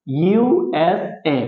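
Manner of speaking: ইউএসএ very slow, U, S, A kept apart; letter by letter. Shown as a demonstration. Teacher repeating for the class, ইউএসএ in slow separate letters. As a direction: give each letter of ইউএসএ its own beat. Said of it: "প্রত্যেকটা অক্ষর আমরা এখানে আলাদা আলাদা উচ্চারণ করতেছি".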